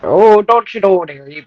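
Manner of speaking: demonstrating a hammed-up Canadian accent; mock Canadian accent with a hard-D "dairy". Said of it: "with a D".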